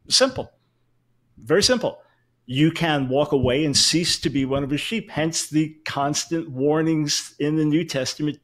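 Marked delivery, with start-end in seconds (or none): 0.1-2.0 Simple jumped up high and louder, then Very simple still high; emphatic high repeat. Real, not acted. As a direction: hammer home Simple with a high, pointed pitch. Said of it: "my exegesis is very simple".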